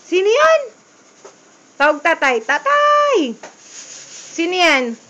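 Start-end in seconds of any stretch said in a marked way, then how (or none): 0.1-0.8 much higher, louder sing-song question; higher playful question. Acted. Asking child, voice rising. 2.4-3.4 calling out. Real, not acted. Calling father to child.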